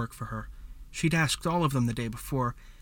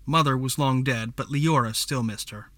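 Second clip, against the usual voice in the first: usual speaking voice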